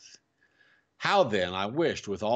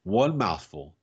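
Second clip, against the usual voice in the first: ordinary delivery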